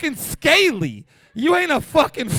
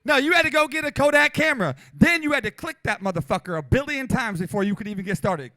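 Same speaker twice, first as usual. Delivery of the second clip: normal talking voice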